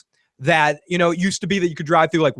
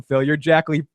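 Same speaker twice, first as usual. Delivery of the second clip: ordinary talk, nothing marked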